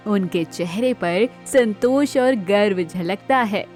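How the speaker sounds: ordinary delivery, nothing marked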